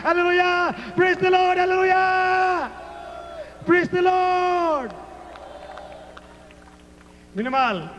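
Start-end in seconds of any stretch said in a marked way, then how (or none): 0.0-4.9 high cries with the last word stretched long; exultant praise shout. Real, not acted. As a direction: shout praise to lift the crowd. Preacher bursts into praise.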